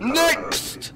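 high-pitched call for the next person. Acted. Checkpoint inspector summons the next person, high call. Calling the next entrant in game.

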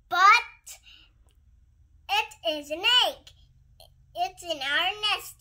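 insistent bird voice. Acted. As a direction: burst out loud, defending the egg. Mister Bird insists to his wife.

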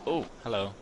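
ordinary talk, nothing marked

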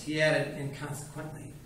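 ordinary delivery, nothing marked

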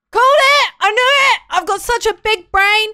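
loud, high gloating cheer; triumphant shout. Real, not acted. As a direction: crow about your win, loudly. Triumphant after solving puzzle.

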